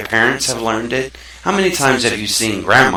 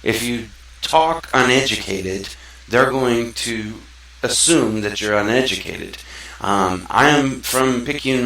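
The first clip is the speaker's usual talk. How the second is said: ordinary talk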